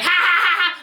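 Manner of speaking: high "ha ha ha" burst; demonstrated loud laugh. Acted. Coach showing how LOL in texts should sound, laughing. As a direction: act out an all-caps LOL. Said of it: "I want it to be like".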